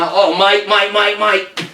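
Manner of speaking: excited British cornerman; British supporters. Acted. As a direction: fuss over the champion in a British accent. Acts British supporters hyping their cat.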